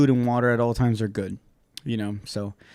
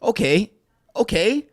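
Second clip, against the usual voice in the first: annoyed mom; 10 semitones higher than usual. Retold or imitated. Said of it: "there's bandages in the cabinet".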